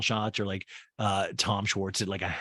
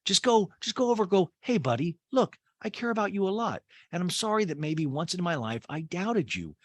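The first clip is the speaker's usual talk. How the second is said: much higher pleading voice; imitating Taylor; 7 semitones higher than usual. Host mimics Taylor urging Shep to apologize, high coaxing. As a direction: coax him gently, pitched way up. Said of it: "And Taylor's like".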